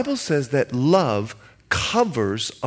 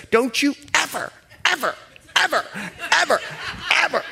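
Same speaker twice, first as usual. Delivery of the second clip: much higher, each ever hammered like a slap; mock scolding mother; 11 semitones higher, faster than usual. Preacher imitating a Bahamian mother whooping her child, high scolding. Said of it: "they'll do this".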